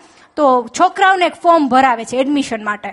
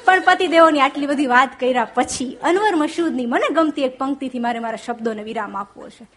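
normal talking voice

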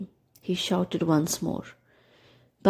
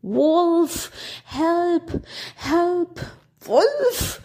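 high, frantic cry for help; desperate shout; 10 semitones higher, much slower than usual. A narrator's reading. Boy alone facing the wolf, desperate high cry. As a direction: scream for help with all his might. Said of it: "he shouted with all his might".

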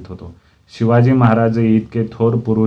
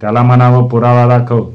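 loud, insistent father; annoyed, raised-voice order; irritated demand; 3 semitones higher, 7 dB louder than usual. A narrator's reading. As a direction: demand it loudly, fed up. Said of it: "बाबा चिडल्यासारखे दिसले".

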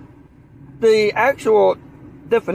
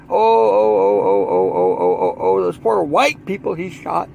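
mock wailing; 10 semitones lower than usual. Mock wailing over the victims.

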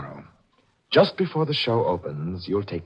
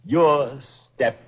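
very slow, pitched-up, measured menace; deliberate menace; 5 semitones higher, much slower than usual. Acted. Killer tells victim her use is over, slowly and menacingly. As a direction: spell out the doom step by step, slowly.

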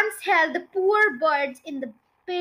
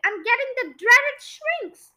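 panicked; 8 semitones higher, 3 dB louder than usual. A narrator's reading. Panicked Mister Twit continues.

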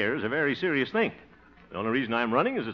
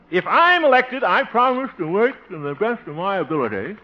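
high, loud stump-speech promise; campaign oratory; 7 semitones higher, 6 dB louder than usual. Pretend. Candidate practising promises to voters, speechifying. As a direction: swell up like a politician on the platform.

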